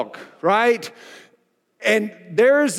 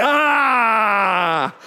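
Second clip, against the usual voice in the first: frustrated groan. Retold or imitated. Pastor catching himself mid-fight, frustrated cry. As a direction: let out a pained, exasperated yell.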